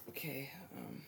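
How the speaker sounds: soft nervous whisper; barely whispering, shy. Real, not acted. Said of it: "I'm not gonna talk that s-, uh, softly".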